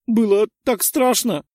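put-on high, loud girl, sobbing it out; sobbing little girl. A narrator's reading. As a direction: wail how scared she was. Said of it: "Она всхлипнула и вытерла слёзы".

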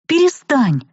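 high, snapping plea to stop; losing patience, cry out; exasperated outburst. Acted. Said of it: "не выдержала я".

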